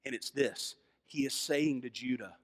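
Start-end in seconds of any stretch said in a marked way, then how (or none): none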